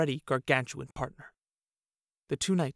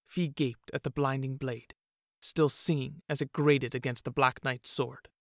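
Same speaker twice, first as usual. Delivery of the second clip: ordinary delivery, nothing marked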